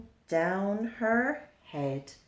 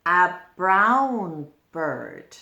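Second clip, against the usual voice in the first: ordinary delivery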